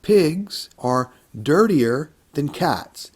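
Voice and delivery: slowed right down, every word clear; slow model sentence. Shown as a demonstration. Teacher modeling a comparative sentence to students, slow and clear. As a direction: lay it out slowly for the class. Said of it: "Let's try a comparative first".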